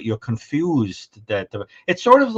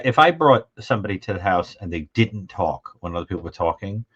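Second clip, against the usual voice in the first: ordinary delivery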